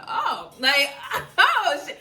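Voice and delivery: loud, high, laughing shock; shocked laughing oh shit. Retold or imitated. Retelling grandma's blessing, loud laughing shock. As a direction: blurt it out in stunned delight.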